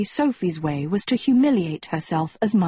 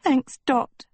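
high, mildly tearful thanks; a touch of a sob through food; sobbing, mouth full; 6 semitones higher than usual. A narrator's reading. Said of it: "Sophie sobbed, mouth full".

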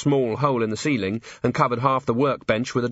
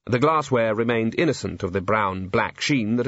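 ordinary delivery, nothing marked

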